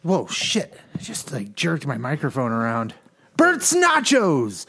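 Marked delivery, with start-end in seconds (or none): 3.4-4.7 high, drawn-out announcer yell; show-name holler. Pretend. Host shouting out the podcast's name, hollered.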